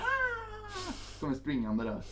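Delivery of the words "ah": high mock shriek; mock scream. Imitating the naked woman chasing him, high shriek. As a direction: shriek like a knife-wielding madwoman. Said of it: "Hon skriker ju hela tiden så här".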